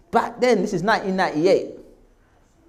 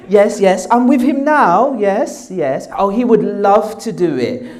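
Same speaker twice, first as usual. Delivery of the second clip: imitated posh mum, higher and louder; posh phone voice; 4 semitones higher, 6 dB louder, slower than usual. Mum on the phone to the BBC, put-on posh voice. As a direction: put on exaggerated poshness for the caller. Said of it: "my mum put on this posh voice".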